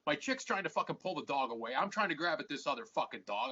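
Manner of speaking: ordinary talk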